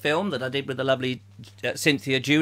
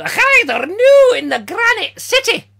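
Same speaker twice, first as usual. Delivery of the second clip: high, loud bad Scottish accent; 7 dB louder than usual. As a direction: attempt a Granite City Scottish accent, badly. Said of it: "I don't know how they speak in the Granite City".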